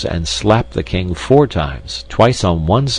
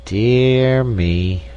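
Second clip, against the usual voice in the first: higher, drawn-out mild sigh; 4 semitones higher than usual. A narrator's reading. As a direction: sigh the words out in one long, sustained tone. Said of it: "sighed the king".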